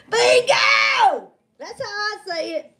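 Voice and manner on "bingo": Bingo! called out high and loud like at a bingo hall; shouted bingo. Shown as a demonstration. Answering a chat question, shouting the word Bingo!. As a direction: belt out Bingo! like calling a win. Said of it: "How do I say bingo?".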